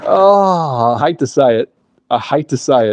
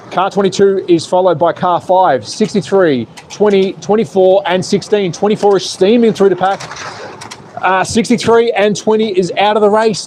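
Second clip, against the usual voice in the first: higher-pitched live play-by-play; live race commentary; 5 semitones higher than usual. Real, not acted. Streamer calling the race for chat viewers, live race commentary. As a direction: call the running order with rising urgency.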